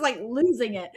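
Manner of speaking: normal talking voice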